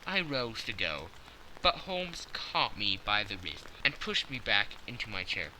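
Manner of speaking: ordinary delivery, nothing marked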